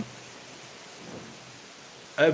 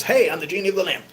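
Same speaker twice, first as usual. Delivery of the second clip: high, bright genie announcing himself; a lightly voiced cheery genie; 7 semitones higher, faster than usual. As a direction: pop out and announce yourself.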